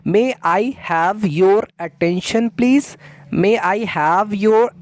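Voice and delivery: very slow, careful teacher read; slow model phrase. Shown as a demonstration. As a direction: repeat it slowly for students to copy. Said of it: "You have to say like that". (धीमा, साफ़ उच्चारण)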